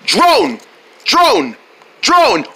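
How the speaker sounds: punchy repeated name chant; crowd cheering. Acted. Crowd cheers the fighter's name, chanting. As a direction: cheer the name in short falling bursts. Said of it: "look at them cheering my name".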